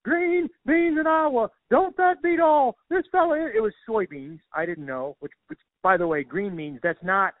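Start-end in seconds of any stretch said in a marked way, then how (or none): 0.0-3.4 very high-pitched, loud mocking farmer voice; mocking Iowa farmers. Farmers laughing at the comedian over his dumb question, high hammed-up mockery. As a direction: hoot it up like farmers ridiculing an outsider. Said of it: "they all started laughing at me".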